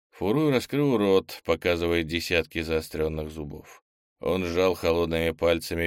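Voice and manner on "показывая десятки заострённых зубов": plain reading voice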